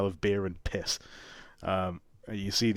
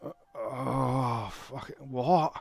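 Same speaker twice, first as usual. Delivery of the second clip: hungover coachman; 4 semitones higher, slower than usual. Acted.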